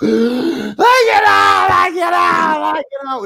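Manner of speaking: gasp, then very high drawn-out shriek; shrieked catchphrase loop, dropping off at the end; winding up again. Retold or imitated. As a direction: imitate a man relaunching into hysterics. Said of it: "and then wind up".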